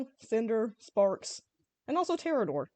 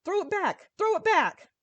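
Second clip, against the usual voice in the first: much higher, loud frantic shout; panicked yell; 10 semitones higher, 6 dB louder, slower than usual. A narrator's reading. Soldiers panicking about the live grenade, frantic yell. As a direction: scream it in a panic.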